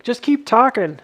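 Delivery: mock viewer egging him on; imitating viewer. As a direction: play the bored viewer begging. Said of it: "You're like".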